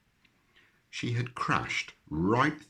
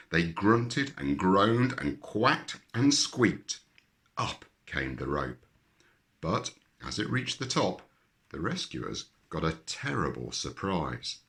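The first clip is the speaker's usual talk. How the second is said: plain reading voice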